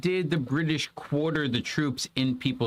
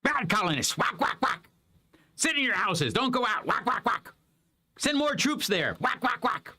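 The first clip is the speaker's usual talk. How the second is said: mocking bossy government; 7 semitones higher than usual. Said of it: "The government just was like".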